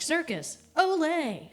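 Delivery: "Circus Olay" said slowly and high, stressing "Olay"; mocking "Circus Olay" for Cirque du Soleil; dumb mispronunciation. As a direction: drawl "Circus Olay" like a clueless boyfriend. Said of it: "He was like".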